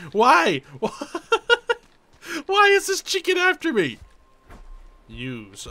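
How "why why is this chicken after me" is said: high-pitched squealing protest; panicked wail. Real, not acted. Gamer chased by a chicken, panicked high wail.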